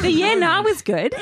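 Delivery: laughing through the praise. Real, not acted. [name] laughs while praising the host's accent. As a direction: giggle out the compliment.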